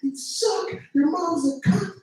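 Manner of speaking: high, furious mock rant from a gamer; raging gamer. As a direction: play an angry kid screaming slurs down a mic. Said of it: "He's like".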